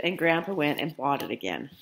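plain reading voice

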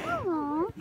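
usual speaking voice

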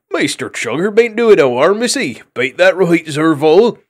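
high, loud country voice; an old sea creature coaxing his friend to agree; old rustic creature. A narrator's reading. Old creature defends Chugger.

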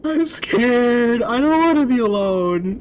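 very high, drawn-out scared whine; frightened wail. Acted. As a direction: wail it like a terrified child. Scared child character left alone.

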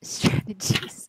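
slow breathy whisper; whispered mutter. Retold or imitated. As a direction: whisper it slowly. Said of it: "does Deku still mutter".

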